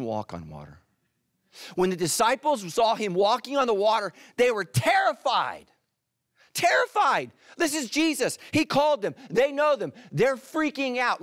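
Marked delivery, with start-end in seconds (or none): 1.8-11.2 much higher and louder, building panic; rising alarm. Acted. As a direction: preach it up to a frantic peak. Preacher builds the disciples' terror.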